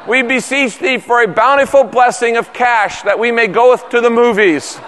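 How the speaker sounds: mock ritualistic prayer. Kids coming up to dad for movie money, mock-reverent solemn pleading. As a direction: intone it like an over-formal prayer. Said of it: "than this ritualistic talk".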